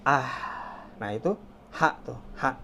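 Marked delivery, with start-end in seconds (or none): 0.0-0.6 long satisfied "ah"; breathy exhale as after sipping tea; refreshed breath-out, demonstrated; sigh after tea. Shown as a demonstration. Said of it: "Seperti kita habis minum teh". 1.8-2.6 "ha" on an open breath; thin, airy "ha" demo. Shown as a demonstration. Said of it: "Seperti kita habis minum teh".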